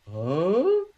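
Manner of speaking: high, stunned disbelief with a rising "huh"; deer-in-the-headlights shock. Retold or imitated. Man re-enacting the moment his cane was grabbed, stunned. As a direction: re-enact freezing in bewildered shock. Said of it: "that sort of deer in the headlights".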